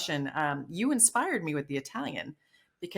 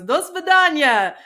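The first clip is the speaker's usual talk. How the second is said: slow, higher, louder mock of his goodbye; imitating a Russian man; 9 semitones higher, 10 dB louder, slower than usual. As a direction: Do svidaniya said slowly, higher and louder, the way he said it. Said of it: "He goes".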